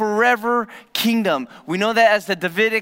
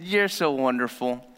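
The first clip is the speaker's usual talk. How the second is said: low, softer mock praise; teasing sarcasm; 6 semitones lower, 4 dB quieter than usual. Couple teasing each other over something dorky, low mock praise. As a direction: tease your partner gently. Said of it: "we knew what that meant".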